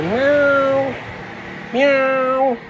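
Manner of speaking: high, slow questioning meows; cat meow. Pretend. To the worker, meowing slowly to explain cats. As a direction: coax out a little kitten meow. Miming cat to non-English speaker.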